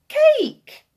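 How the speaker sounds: put-on high, loud child's shout; triumphant cry. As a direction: burst out with a piercing, gleeful punchline. Children's big punchline to giant.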